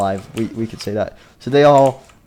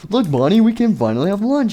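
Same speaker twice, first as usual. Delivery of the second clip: high, excited character voice; thrilled exclaiming; 8 semitones higher, 3 dB louder than usual. Acted. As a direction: exclaim it high with giddy delight. Nightmare Freddy thrilled at finding people.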